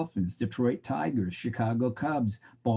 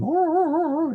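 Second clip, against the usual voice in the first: much higher, louder seal barks; excited seal barks; 7 dB louder than usual. Retold or imitated. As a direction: bark like a thrilled seal getting a fish. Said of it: "it goes".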